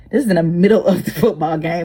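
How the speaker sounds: usual speaking voice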